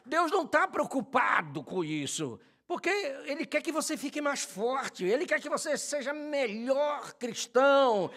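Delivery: loud, high fiery sermon climax; booming pulpit preaching; preaching at full force. Acted. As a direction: rouse the congregation at full voice.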